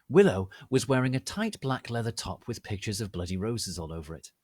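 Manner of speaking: plain reading voice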